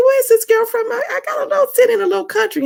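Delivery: high, loud, fast and excited. Acted.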